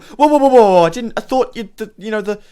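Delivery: high, flustered stammer, loud at first; acted panic, pleading as you scramble away; panicked villager. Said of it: "she's, like, scrambling to get back inside".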